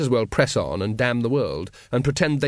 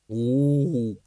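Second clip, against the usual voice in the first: ape hoot. Acted. Librarian answers.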